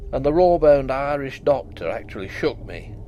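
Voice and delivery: plain reading voice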